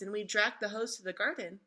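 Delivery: ordinary delivery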